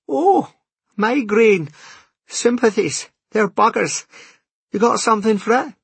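put-on quick, sympathetic young waitress.

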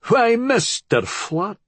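high, slow Scottish welcome; broad Scottish host, drawn out; thick Scottish accent. A narrator's reading. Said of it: "the man said in a thick Scottish accent".

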